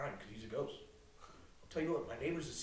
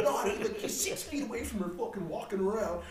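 ordinary talk, nothing marked

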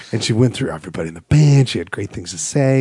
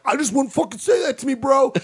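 mock bro; 13 semitones higher than usual. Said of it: "one of them's like".

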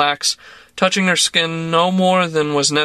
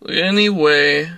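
glum; slower than usual. A narrator's reading. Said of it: "she said glumly".

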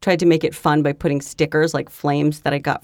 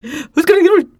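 high, loud turkey squawk; mocking turkey voice; 12 semitones higher, 6 dB louder than usual. Podcaster mimicking co-host's question, turkey-like squawk. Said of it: "You sounded like a turkey".

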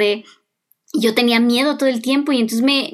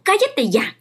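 mother snapping; 7 semitones higher, slower than usual. Retold or imitated. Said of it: "Y un día me dijo".

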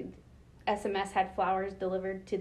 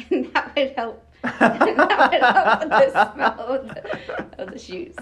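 laughing through the words; giggling while telling it; 4 semitones higher, 15 dB louder, slower than usual. Real, not acted. Funny story punchline, laughing.

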